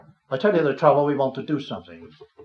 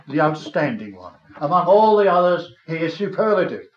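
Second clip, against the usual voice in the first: higher, louder, building praise; rising fervent preaching; 4 semitones higher, 7 dB louder than usual. Acted. Preacher exalting Christ to the saints, fervent and rising. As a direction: swell with wonder at the glorious tree. Extols Christ as apple tree.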